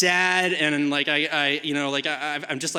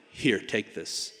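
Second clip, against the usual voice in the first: low, slow, soft grandpa voice; unruffled grandpa; 10 semitones lower, 8 dB quieter, slower than usual. Retold or imitated. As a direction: hand it over calmly, no hurry. Calm grandpa voiced against frantic kid.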